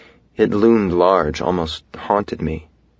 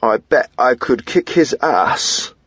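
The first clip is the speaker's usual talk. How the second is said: put-on slow, higher, louder playful British accent; mock British accent; 6 semitones higher, 3 dB louder, slower than usual. A narrator's reading. River teasing Dahlia in a hammed-up British accent. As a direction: ham up a British accent to tease her. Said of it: "whisper to her in my best British accent".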